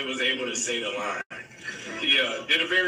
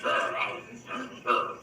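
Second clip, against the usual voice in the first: Harrison Ford; 3 dB quieter, slower than usual. Retold or imitated. Actor mimicking his co-star's way of talking, grumbled imitation. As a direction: grumble gruff nonsense syllables in imitation. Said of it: "That's how Harrison talks".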